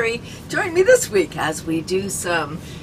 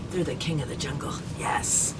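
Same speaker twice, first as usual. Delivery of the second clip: whispered; 9 semitones lower, 11 dB quieter than usual. Real, not acted. Hushed watching lions.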